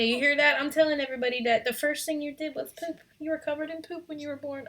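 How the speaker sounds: teasing singsong to an infant. Real, not acted. Mother teasing her baby mid-story.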